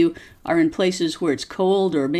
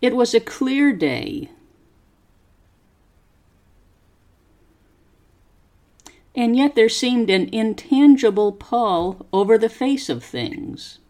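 slow, careful story reading for students to repeat; slow model reading; 3 semitones higher than usual. Shown as a demonstration. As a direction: pause after each phrase and lift the tune clearly. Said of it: "Copy how my voice goes up and down".

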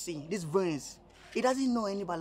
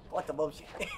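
ordinary delivery

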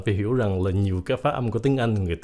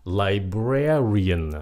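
LIBRARIAN very slowly, as li-BRAR-ian; stress on second syllable; much slower than usual. Shown as a demonstration. Teacher models the word, slow li-BRAR-ian. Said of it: "nhấn âm hai".

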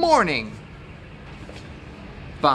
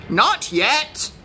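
high-pitched rooster's refusal; rooster character; 9 semitones higher than usual. A narrator's reading. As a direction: answer as a rooster, pitched well up. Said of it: "said the rooster".

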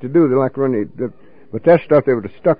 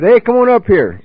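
higher, louder call across the street; 6 semitones higher, 3 dB louder than usual. Retold or imitated. Marv calling the drunk friend over from up the street, higher and louder. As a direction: call him over from a distance.

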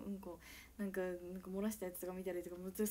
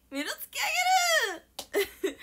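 excited cry; 12 dB louder than usual. Real, not acted. Excited reaction continues.

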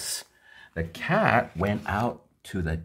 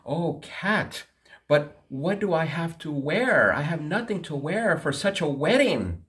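worried poor man; 8 semitones higher than usual. Acted.

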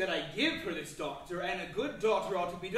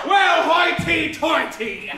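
loud, slow, higher shout with a laugh; scornful outburst; 5 semitones higher, 12 dB louder, much slower than usual. Acted. Master scoffing at the servant's defiance, loud laughing outburst. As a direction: explode with indignant laughter.

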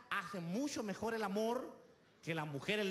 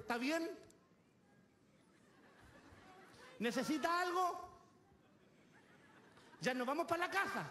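higher-pitched mock feminine questions; pretty woman imitation; 8 semitones higher than usual. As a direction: put on a high voice, lifting each phrase at the end. (imitación de mujer linda, voz aguda)

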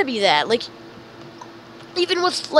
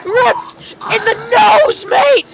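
high, drawn-out taunting shout; gleeful trash-talk squeal; gloating yell; 10 semitones higher, 4 dB louder, much slower than usual. Real, not acted. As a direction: crow it loud and very high. Gloating over a kill.

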